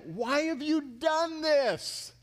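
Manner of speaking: high, slow, drawn-out demand; accusing cry. Acted. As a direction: cry it out in disbelief, stretching each word. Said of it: "and said to him".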